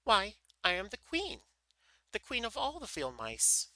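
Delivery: high, loud mouse queen in a huff; indignant. A narrator's reading. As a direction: assert your rank in an indignant little voice. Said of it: "cried the little animal indignantly".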